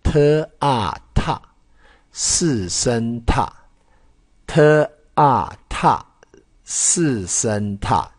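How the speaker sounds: 特啊踏 said slowly, t then a blended into tà, then 四声踏 with a falling fourth tone; slow pronunciation drill. Shown as a demonstration. Teacher to students spelling out 踏 slowly. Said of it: "那这个要念作踏".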